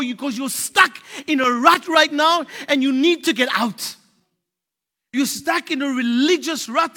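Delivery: loud, driving preaching. Acted. Preacher to congregation, loud fervent preaching. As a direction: push them out of the rut.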